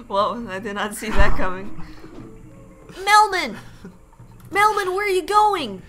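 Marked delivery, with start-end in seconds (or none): 3.1-5.8 high, loud playful call; raised mock-pleading shout; calling after someone. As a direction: call out as if to someone walking away. Calling after game character.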